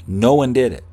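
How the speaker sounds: ordinary talk, nothing marked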